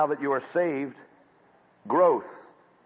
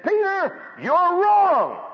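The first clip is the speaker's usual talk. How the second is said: sharp, much higher, louder rebuke; Paul rebuking Peter; 6 dB louder, much slower than usual. Retold or imitated. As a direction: call him out to his face. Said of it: "He confronted Peter and said".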